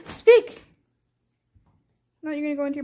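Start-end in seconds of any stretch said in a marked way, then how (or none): 0.1-0.6 high, loud gasped "speak"; excited command. Real, not acted. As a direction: urge the dog to bark, thrilled. Gasping command to dog.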